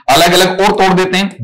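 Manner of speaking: normal talking voice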